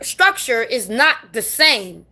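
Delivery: usual speaking voice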